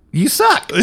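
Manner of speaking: sudden high-pitched jab; high, comic burst of scorn; mock outburst. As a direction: jump way up in pitch, playfully insulting. Mock tirade at Android users.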